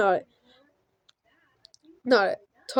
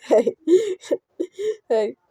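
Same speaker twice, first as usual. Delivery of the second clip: laughing; 8 semitones higher than usual. Real, not acted. Laughing at own action.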